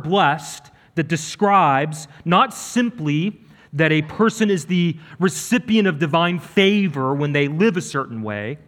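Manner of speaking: usual speaking voice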